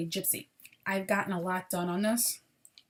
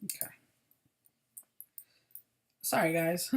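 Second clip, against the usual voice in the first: ordinary talk, nothing marked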